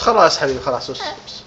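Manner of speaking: low, soft hushing; gentle, quiet comfort; soothing. Real, not acted. Parent hushing a crying child.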